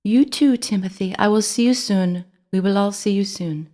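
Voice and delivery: high French mouse voice, putting on courage; a little French mouse bravely comforting him; brave reassurance. A narrator's reading. Ellie comforts Timothy at the grate, brave French accent. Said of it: "trying to be brave".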